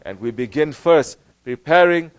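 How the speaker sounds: loud, high impassioned sermon; preaching at full force. Acted. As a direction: preach it out to the crowd. Preaching with raised voice.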